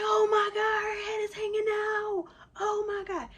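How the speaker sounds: loud, high-pitched mock panic; hammed-up freak-out, wailed way up high; panicking husband. Wife imitates husband seeing the water bag, panicked wail.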